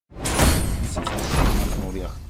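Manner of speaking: "Bütün şansını oraya akıt" whispered, very slow. Shown as a demonstration. Streamer willing luck onto the roll, slow whisper. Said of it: "repeated as whisper".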